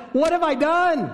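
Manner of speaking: high desperate wail; a father's horror breaking out of him; desperate cry. Acted. Father realizing his vow, desperate high cry. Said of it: "This cry of".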